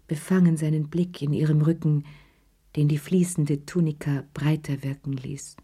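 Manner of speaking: ordinary delivery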